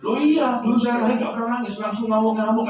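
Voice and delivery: loud, high, indignant self-defense; hammed-up stubborn man justifying himself; mocking someone who excuses their temper; mock defensive excuse. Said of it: "katanya begitu".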